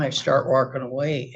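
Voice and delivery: normal talking voice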